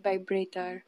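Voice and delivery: low, soft Filipino-accented demo. Shown as a demonstration. Showing how Filipinos say their V's, soft Filipino accent. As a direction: say it Filipino-style, B for V. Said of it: "that's how Filipinos say their V's".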